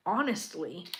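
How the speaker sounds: ordinary talk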